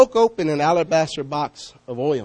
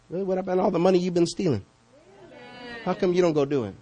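ordinary talk

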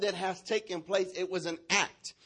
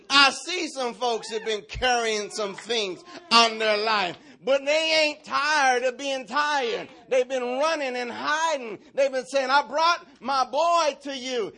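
high, loud, drawn-out sermon delivery; full-force preaching; 9 semitones higher, 7 dB louder, slower than usual. Real, not acted. Preacher calls out folks carrying burdens, preaching at full force. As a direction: preach it out with rising fervour.

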